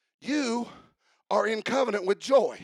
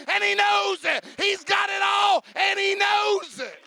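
preaching at full force; 6 semitones higher than usual. Acted. Preacher drives the congregation to a climax, shouting higher. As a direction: build the call to a peak.

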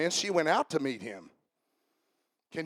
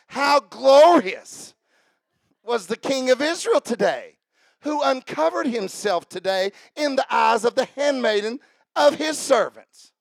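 high, loud, stretched-out mock praise; heavily sarcastic; 6 semitones higher, 8 dB louder, slower than usual. Wife mocking her husband the king, heavily sarcastic. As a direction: pile on the sarcasm, dragging out GLORIOUS. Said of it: "I think she said it like this".

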